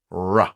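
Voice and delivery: "R" as a loud phonics sound, not the letter name; isolated R sound. Shown as a demonstration. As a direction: voice "R" as a drawn-out rolling-in "rrruh". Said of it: "we've got the first sound there".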